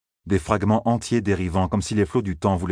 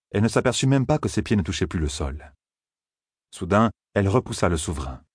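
ordinary talk

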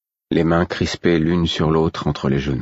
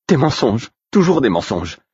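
high, bitter lament; pitched-up, aggrieved exclamation; bitter, to herself; 7 semitones higher than usual. A narrator's reading. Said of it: "dit-elle en s'adressant plus à elle-même qu'à moi".

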